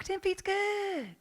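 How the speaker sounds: put-on high, slow Southern accent; exaggerated Southern accent. Mimicking how a friend tells the story, hammed-up Southern accent. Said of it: "I'm real, real Southern".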